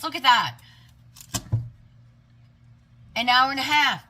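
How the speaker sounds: high, loud burst of amazement; real excited outburst; excited exclamation. Real, not acted. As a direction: call it out high and loud, thrilled. Surprise at how long it took.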